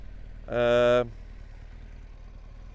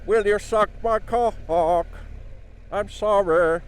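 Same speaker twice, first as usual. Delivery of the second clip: [name] impression; 6 semitones higher, slower than usual. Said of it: "[name] in sexual situations".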